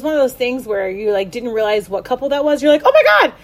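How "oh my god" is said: high, fast, thrilled squeal; breathless high-pitched excitement; excited gasp. Retold or imitated. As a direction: gush like you just spotted the baby. Gasping at the TV reveal.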